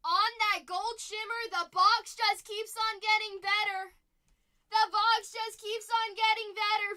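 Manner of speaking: loud, high announcer-like hype; excited hype shout. Real, not acted. Host reveals gold Darth Vader card, excited shout. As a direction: belt out the big pull to the crowd.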